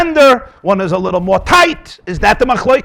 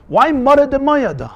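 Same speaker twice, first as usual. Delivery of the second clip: usual speaking voice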